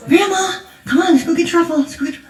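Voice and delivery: put-on high, eager child's plea; a little girl pulling grandma along; eager granddaughter. Granddaughter begging grandma every day.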